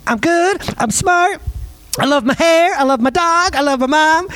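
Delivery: put-on high, bright little girl reciting affirmations. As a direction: chirp it out like a proud little girl in the mirror. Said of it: "She's like".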